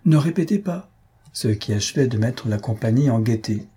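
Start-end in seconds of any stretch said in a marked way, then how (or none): 0.0-0.9 higher, commanding order; imperious order. A narrator's reading. Mistress ending the dispute with a curt order, imperious. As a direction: cut the argument short with a command. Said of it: "par cet ordre impératif".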